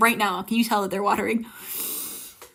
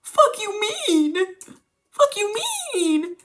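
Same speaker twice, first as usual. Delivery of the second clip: high, slow, incredulous question; much higher, stretched-out retold shock; stunned disbelief; 8 semitones higher, much slower than usual. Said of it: "retelling reaction to brother".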